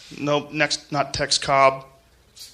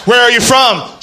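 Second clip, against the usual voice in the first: shouting; 5 semitones higher, 8 dB louder than usual. Pretend. Shouting to a stranger afar.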